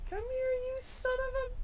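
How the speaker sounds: high, slow, sweet singsong call; high sweet "Come here" hiding a curse; inviting singsong. Shown as a demonstration. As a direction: sing the curse as a friendly invitation. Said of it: "in a tone that maybe sounds a little bit inviting".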